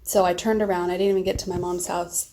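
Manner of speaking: ordinary talk, nothing marked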